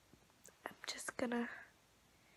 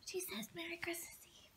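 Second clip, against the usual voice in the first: breathy whisper speaking for the dog; dog's secret whisper; tiny whispered dog voice; 2 semitones higher, 19 dB quieter than usual. Pretend. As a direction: pretend the dog whispers back. Voicing dog's tiny reply.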